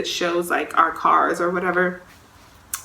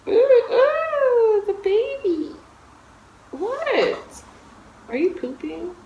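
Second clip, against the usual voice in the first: very high, cooing voice; real high-pitched talk to an infant; slower than usual. Real, not acted. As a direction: coo to your newborn. Breaks off to talk to baby.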